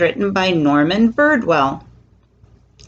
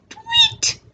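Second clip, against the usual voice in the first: very high little bird tweet. A narrator's reading. Storyteller voices the silly bird, high tweet. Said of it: "The bird says".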